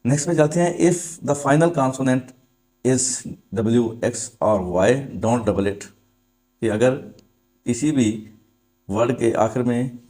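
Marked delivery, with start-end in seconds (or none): 0.8-5.8 very slow, word by word; slow dictation. Shown as a demonstration. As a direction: dictate the rule for copying. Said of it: "dictating rule slowly". (धीमे-धीमे नियम लिखवाना)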